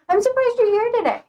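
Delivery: playful fake amazement; mock surprise. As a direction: pretend shock at a viewer showing up. Teasing greeting to viewer.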